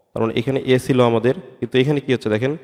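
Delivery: usual speaking voice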